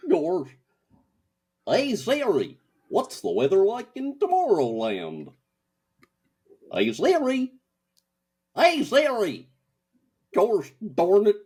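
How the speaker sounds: high goofy cartoon voice, getting frustrated; dopey cartoon dog pleading with his phone. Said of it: "Goofy is having trouble with Siri".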